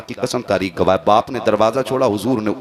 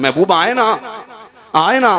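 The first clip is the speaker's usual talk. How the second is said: calling out tearfully; 8 semitones higher, 3 dB louder than usual. Acted. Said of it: "बच्चा रो के अपने हुजूर को आवाज दे रहा है".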